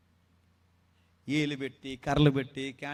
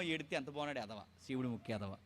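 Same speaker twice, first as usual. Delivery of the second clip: hushed, much softer taunt "ఏడ్రా ఎదవా"; mocking tease; 5 semitones lower, 10 dB quieter than usual. Adult teasing a crying child, soft mocking coo.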